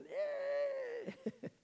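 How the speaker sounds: very high, drawn-out squeal; high-pitched joking "eh"; mock cheer. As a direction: squeal a long, playful hooray.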